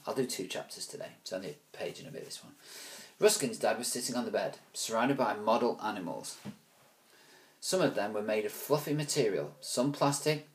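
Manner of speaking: plain reading voice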